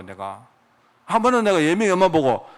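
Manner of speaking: usual speaking voice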